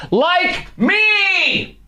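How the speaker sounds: high crying whine; mock whining. Host without power like the woman, mock whining. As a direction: wail it in hammed-up self-pity. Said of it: "but I'm not whining".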